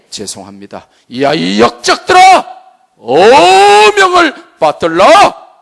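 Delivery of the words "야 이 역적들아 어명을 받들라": slow booming royal command, much higher and louder; historical drama king. Acted. Preacher playing a king commanding traitors, booming royal decree. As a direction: bellow like a king in a period drama. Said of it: "저분들이 사극을 많이 보셨어요".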